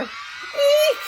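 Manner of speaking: high-pitched scared eek; high squeal. Acted. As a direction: squeal in fright. Bystander screams.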